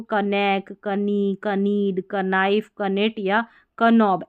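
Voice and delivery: कनेक कनी कनीड कनाइफ said with a hard K before the N; each word starting with a sounded K; wrong K sounded. Shown as a demonstration. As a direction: demo the common mistake of sounding the K. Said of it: "ऐसे करके पढ़ देते हैं".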